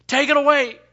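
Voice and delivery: loud pleading. Retold or imitated. Paul pleading with God.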